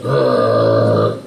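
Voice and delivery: very low growl; Goliath the giant growling, very low. Retold or imitated. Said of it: "Goliath's like".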